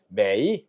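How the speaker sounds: 매이 dipping low, then lifting; full third tone. Shown as a demonstration. Teacher shows students the unchanged tone, 매이 falling then rising. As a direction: let 매이 sink before it rises. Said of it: "그대로 발음하면".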